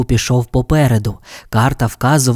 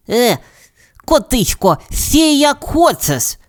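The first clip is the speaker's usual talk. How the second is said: high, light canary voice; chirpy little bird speaking up; 12 semitones higher, slower than usual. Acted. Tweety tells the cat his plan in a high bird voice.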